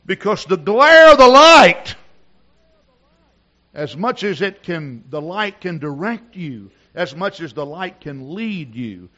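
loud, high, slow pulpit cry; shouted sermon, drawn-out and high; full-force preaching. Real, not acted. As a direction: preach it at the top of your lungs.

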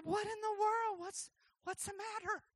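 high-pitched gentle questioning; lightly voiced baffled father; concerned, puzzled dad. Voices dad comforting crying child.

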